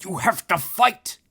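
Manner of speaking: high, loud furious bark; sputtering rage. Acted. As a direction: spit it out in fury. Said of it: "sputtered the Hierophant".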